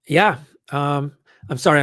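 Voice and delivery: ordinary talk, nothing marked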